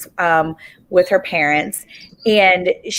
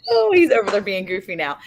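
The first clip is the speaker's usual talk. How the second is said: normal talking voice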